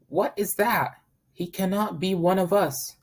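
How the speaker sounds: mocking duckling. Yellow duckling pointing at the newcomer, sneering. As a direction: jeer like a snotty chick. Duckling mocks the odd bird.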